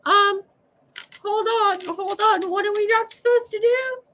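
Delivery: put-on very high, childish squeaky voice asking Mother Sophia; mock dim-witted follower, high and squeaky; squeaky confused human. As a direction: play a clueless squeaky human asking for the rule.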